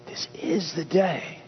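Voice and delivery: slow, drawn-out "day" at the end; stress on day. Shown as a demonstration. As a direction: linger on "day" in "This is the day". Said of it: "You put that accent on any word".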